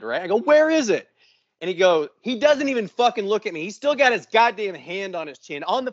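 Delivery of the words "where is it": much higher, louder retold demand; mad and elevated. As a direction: re-enact exasperation boiling over. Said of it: "I'm getting fucking mad and elevated".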